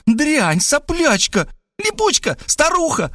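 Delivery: high female voices hurling insults; trading insults. Acted. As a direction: fling each insult, very high. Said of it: "последовала в ответ". (высокие женские выкрики-оскорбления)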